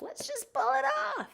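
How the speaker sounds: squeaky high mock self; silly high voice. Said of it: "Mine are like".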